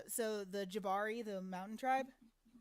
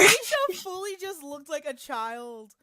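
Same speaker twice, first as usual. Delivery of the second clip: teasing laugh; 5 semitones higher, 13 dB louder, slower than usual. Real, not acted. Teasing friend loudly.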